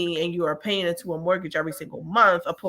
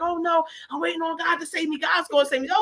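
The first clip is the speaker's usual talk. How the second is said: high, shouted refusal; the drowning man refusing a ride with a high mock shout; stubborn drowning man; 9 semitones higher than usual. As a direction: insist God will save him, voice up high.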